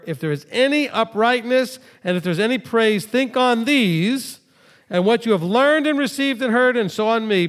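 preacher reading scripture to the congregation. Acted. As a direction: proclaim the verses from the pulpit.

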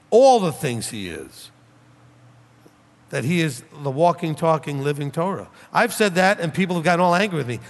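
ordinary delivery

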